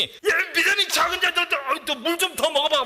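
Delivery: high, loud taunting rebuke; mocking scold. Shown as a demonstration. Preacher showing the wrong, scornful Jesus. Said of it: "이거 아니라고요".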